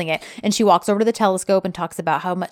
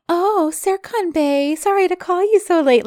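mocking imitation; 10 semitones higher than usual. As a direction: mimic a rival in a fake sweet voice. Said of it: "mimicking Balja".

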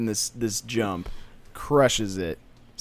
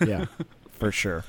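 normal talking voice

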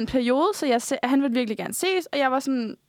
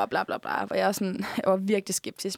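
ordinary delivery

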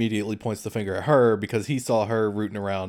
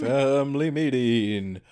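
slow, stretched-out summons; drawn-out call; much slower than usual. Acted. Co-host echoing the family meeting call, drawn out. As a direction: stretch each syllable like a town crier.

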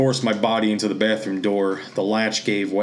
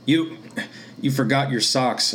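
choked out; 4 semitones higher than usual. Acted. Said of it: "I choked out".

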